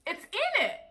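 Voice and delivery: high-pitched, incredulous protest; high, exasperated point-making; exasperated insistence. Retold or imitated. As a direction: insist on the obvious, pitch jumping up. Exasperated that she ignored it.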